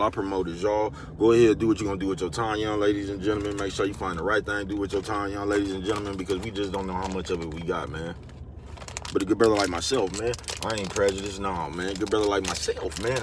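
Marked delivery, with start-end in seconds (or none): none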